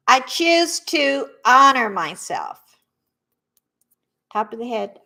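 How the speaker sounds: a calm coach setting the words out one by one; tapping affirmations. Shown as a demonstration. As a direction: lead the group through each phrase. Repeat-after-me tapping affirmations.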